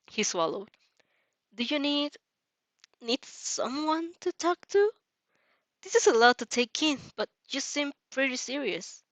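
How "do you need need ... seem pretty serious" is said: put-on higher, gentle boyish voice, a bit hesitant; lightly voiced worried boy; Izuku's voice. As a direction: lift the pitch for a nervous shy boy.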